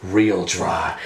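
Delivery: very low, understated parting shot; deadpan noir detective. Retold or imitated. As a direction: toss it off low and cool like a TV cop. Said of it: "he goes".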